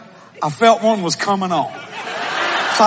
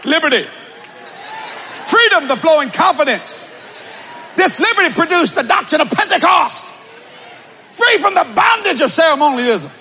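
preaching at full force; 8 semitones higher than usual. Acted. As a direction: shout the freedom out with joy.

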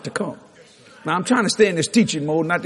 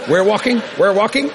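louder, higher repeated urging; insistent urging; 5 semitones higher, 5 dB louder than usual. Retold or imitated. Preacher hurries reluctant people along with him, raised insistent voice. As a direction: keep them moving with a raised, lilting call.

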